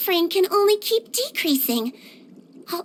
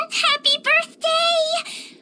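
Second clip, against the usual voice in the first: laugh, then a much higher, slow, beaming happy birthday; excited birthday greeting; 11 semitones higher, much slower than usual. Acted. Healer greets the player on their birthday, laughing and much higher. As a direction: burst out with joy and stretch it out.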